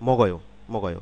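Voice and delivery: মগয় in one go, k voiced as g; মগয়, g carried into the vowel; quick linked pronunciation. Shown as a demonstration. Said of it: "দ্রুত বললে কিন্তু মগয়ই হবে".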